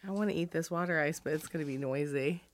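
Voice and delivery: mouth full. Real, not acted. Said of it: "I have some water ice in my mouth".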